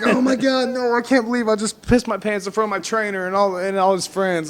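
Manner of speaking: high, panicky, a different voice; high, panicked wail, retold for laughs; mortified. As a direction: babble it out in mounting horror. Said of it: "I'm like".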